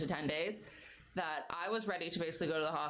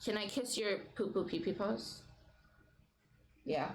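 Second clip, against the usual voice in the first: normal talking voice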